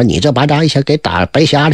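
plain reading voice

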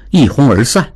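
usual speaking voice

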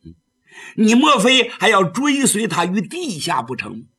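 usual speaking voice

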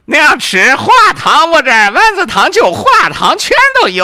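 loud, high hawker's shout; vendor shouting. Acted. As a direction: yell it out to the crowd. Vendor shouting his offer.

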